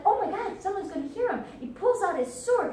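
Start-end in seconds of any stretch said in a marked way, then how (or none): 0.0-1.4 high, alarmed outburst; mock panic, pitched up; panicked reaction. Montresor fearing someone will hear, panicked. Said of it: "Montresor's like".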